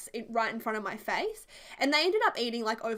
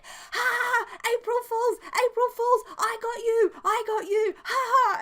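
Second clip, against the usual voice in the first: much higher, louder cackling taunt; gloating grandma; 12 semitones higher, 8 dB louder than usual. Retold or imitated. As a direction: gloat over the prank. Grandma gloating April Fools.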